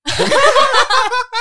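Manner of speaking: high, loud burst of laughter; real high-pitched laughing fit. Real, not acted. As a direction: crack up hard at the joke. Friends cracking up at joke.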